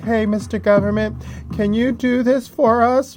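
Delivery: high, timid pleading voice; weak petitioner. Retold or imitated. Mocking petitioners writing to the government, timid high plea. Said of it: "they act very weak".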